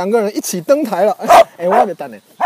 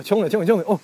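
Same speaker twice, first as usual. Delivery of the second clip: normal talking voice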